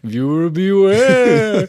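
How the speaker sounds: slow, loud, hammed-up spooky announcer; mock horror host. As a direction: intone it slowly like a campy horror-film trailer.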